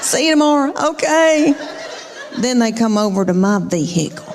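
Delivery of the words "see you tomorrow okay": high, sing-out goodbyes to the teachers; mock cheery girls. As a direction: imitate kids in a good mood, pitched up. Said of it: "they are in a good mood".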